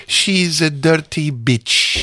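put-on slow, Indian-accented English insult. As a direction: imitate Sheela's Indian accent. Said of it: "Das war eine Imitation".

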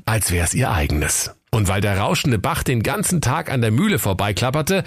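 ordinary talk, nothing marked

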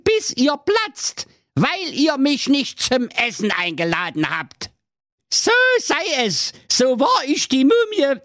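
sehr hohe, quäkende Hexe; Hexenstimme. Acted. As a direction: genervte alte Hexe. Hexe spricht weiter. (hohe Hexenstimme)

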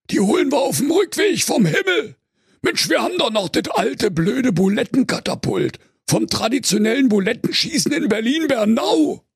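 mock the bored wolf; high-pitched slangy bear teen; cheeky teen bear.

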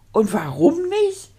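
astonished. A narrator's reading. Duke questioning the knight about the trophy, astonished. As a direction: ask it with puzzled amazement. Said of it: "staunte der Herzog".

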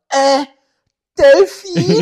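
high, unsure caller voice; quiz caller. Retold or imitated. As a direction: guess timidly like a call-in player. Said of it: "dann ruft wieder einer an". (hohe, unsichere Anruferstimme)

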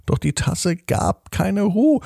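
plain reading voice

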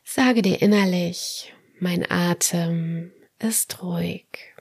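guided meditation. Acted. Leads autogenic training relaxation.

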